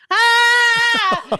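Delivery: piercing mock dog scream; imitated dog scream. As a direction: shriek like the panicked poodle.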